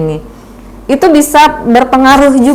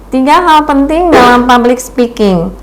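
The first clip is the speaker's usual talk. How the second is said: higher, thin reading-aloud student voice; mock nervous student reading from a script; imitating a student's reading voice; 5 semitones higher than usual. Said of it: "mahasiswa-mahasiswi saya yang suaranya seperti itu".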